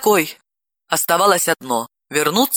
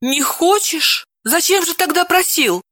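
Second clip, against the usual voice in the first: astonished; 11 semitones higher than usual. A narrator's reading. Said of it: "поразилась она".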